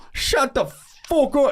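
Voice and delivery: high, slow, exploding yell; imitating a furious shout. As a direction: mimic a stranger losing it in line, stretched out and high. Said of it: "And the dude was like".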